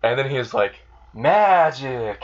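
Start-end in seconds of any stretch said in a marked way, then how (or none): 1.1-2.2 showy. Retold or imitated. Subaru showing off his trick.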